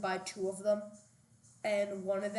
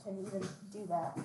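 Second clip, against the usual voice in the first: normal talking voice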